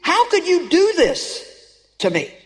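lightly acted grief, asking why; pained plea. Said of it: "you're kinda like saying".

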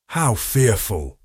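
slow, lightly sobbing words; a touch of tearful sobbing. Acted. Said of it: "she sobbed".

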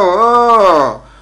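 loud, high "oh"; defiant cry. Acted. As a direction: belt it out in defiance. Defies the friend hushing him. (δυνατή, πεισματική κραυγή)

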